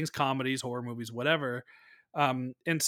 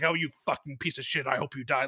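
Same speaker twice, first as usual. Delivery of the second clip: ordinary delivery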